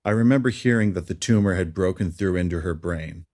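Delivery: usual speaking voice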